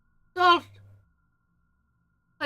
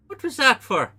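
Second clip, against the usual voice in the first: farmer's voice; 10 semitones higher, 6 dB louder than usual. Acted. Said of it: "and be like".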